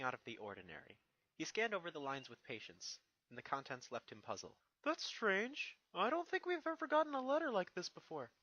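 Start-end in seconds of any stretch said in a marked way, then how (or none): 4.8-8.3 put-on high, loud young dragon voice. Young dragon asks Twilight about the letter, high and eager. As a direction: play the eager little dragon, pitched up.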